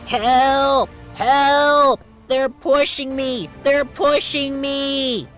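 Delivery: high, loud drawn-out wail; panicked cry for help. A narrator's reading. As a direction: call desperately for rescue, stretching the words. Runaway engine cries for help.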